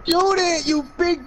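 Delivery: high, urgent heckle; exasperated yell. Real, not acted. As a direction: holler it at a teammate. Yelling at teammate.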